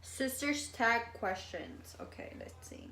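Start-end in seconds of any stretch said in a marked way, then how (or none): 2.0-2.9 whispered. Real, not acted. Reading questions off phone.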